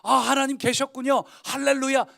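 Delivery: loud, high, hammed-up joy; a pretend Gideon cheering at the angel's news; mock delight. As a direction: play the overjoyed believer, tongue in cheek. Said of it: "기드온이 막 신나서".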